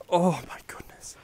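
breathless whisper of awe; awed whisper. Real, not acted. Angler holding the huge fish, whispering in awe. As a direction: barely breathe it out, stunned.

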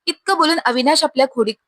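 ordinary delivery

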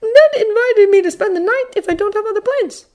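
put-on high, self-conscious kid's voice; bashful boy. A narrator's reading. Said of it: "in a somewhat bashful tone".

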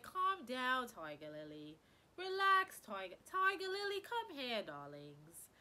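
hammed-up London accent calling Tiger Lily; mock London accent. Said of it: "I think I'm from London".

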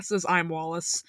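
plain reading voice

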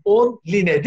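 "online" sounded out as on-li-ne; read as spelled. Shown as a demonstration. Speaker checking the spelling with colleagues, "online" as on-li-ne. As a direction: pronounce every vowel in "online", on-LEE-neh. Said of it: "Öyle yazılıyor".

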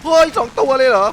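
real alarm, high and loud; startled terrified cry; panicked shout. Real, not acted. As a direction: yell in shock at two monsters. Two monsters appear.

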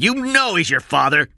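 loud, high accusation; angry shout. A narrator's reading. Cy presses the boy about his father, shouting. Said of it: "Cy shouted".

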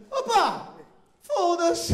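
loud, high burst of a cheerful curse; shouted entrance. Retold or imitated. Bursting into the shop, a loud high yell. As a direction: barge in yelling it.

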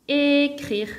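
"écrire" slowly cut into é, crire; split into syllables. Shown as a demonstration. Teacher giving two-syllable verbs, "écrire" broken in two. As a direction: draw out the first beat of "écrire", then crire. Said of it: "un verbe avec deux syllabes".

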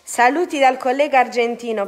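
usual speaking voice

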